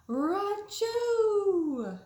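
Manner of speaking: loud, high acted sneeze; big sneeze. The bear wakes with a huge loud sneeze. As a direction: blast out a huge fake sneeze.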